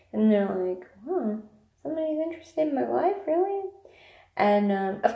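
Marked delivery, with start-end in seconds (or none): 1.1-3.7 high, quick incredulous question; surprised disbelief. Retold or imitated. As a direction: sound amazed someone cares. Stranger surprised anyone asks.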